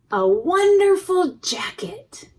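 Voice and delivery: high, loud breathy wonder, slowed; excited whisper. A narrator's reading. Reading to children, revealing the jacket with high excited wonder. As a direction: reveal it with delight.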